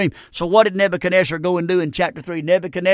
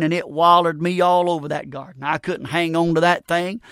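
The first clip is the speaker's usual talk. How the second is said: usual speaking voice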